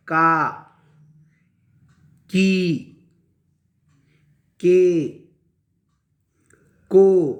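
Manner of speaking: long, isolated syllables with wide pauses; teacher's read-along recitation; direct syllable reading. Shown as a demonstration. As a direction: read each joined syllable out firmly, drawn out. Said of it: "हुर्फों को अलग किए बग़ैर direct".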